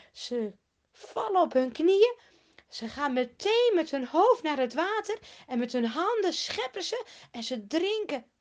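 ordinary delivery

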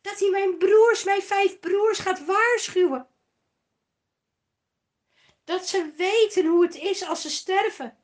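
loud, high, hurried pleading; urgent high loud plea to Abraham; rich man insisting. A narrator's reading. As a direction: beg for his five brothers. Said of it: "hield de rijke man aan".

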